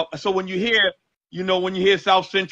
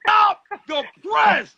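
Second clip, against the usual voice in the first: excited shout; 12 semitones higher, much slower than usual. Real, not acted. Host stopping the song to rewind it, excited high shout. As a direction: yell to halt the track in excitement. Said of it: "He said he gotta rewind".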